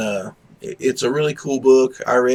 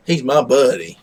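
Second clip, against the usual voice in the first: ordinary delivery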